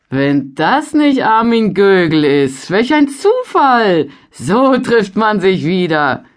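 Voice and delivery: laut, höher und langsam ausgerufen; überrascht und herzlich begrüßen; freudige Begrüßung. A narrator's reading. Freudiges Wiedersehen in der Gaststube. (Händler begrüßt Armin freudig überrascht)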